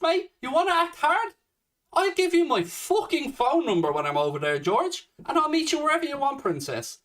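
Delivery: real heated rant, pitched high; angry challenge. Real, not acted. Angry challenge to online commenter George.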